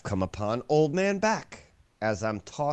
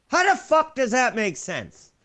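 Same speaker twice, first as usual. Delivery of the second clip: exasperated; 11 semitones higher, 5 dB louder than usual. Real, not acted. Ranting about bank loan rules.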